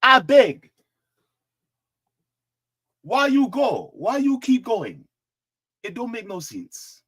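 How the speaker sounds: put-on high-pitched pleading in African accent; best African accent. Begging Nikki to stop running, in a high African accent. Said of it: "in my best African accent".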